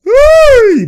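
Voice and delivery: loud, very high shriek; excited squeal. Real, not acted. Reacting to a great pass, high excited shriek. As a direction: let the word shoot up into a squeal.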